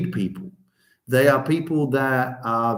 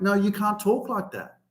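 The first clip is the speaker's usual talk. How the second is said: lightly voiced parental scolding, higher; a hint of parents laying down the law; 5 semitones higher, 4 dB quieter than usual. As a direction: parents telling off their grown son. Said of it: "they're trying to tell me".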